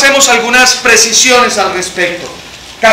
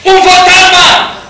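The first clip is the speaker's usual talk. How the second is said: high, drawn-out cry; grito de miedo; 7 semitones higher, 4 dB louder, slower than usual. Acted. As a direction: shout it out as frightened men would. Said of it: "Y gritaron, ¿de qué? De miedo". (grito agudo y alargado)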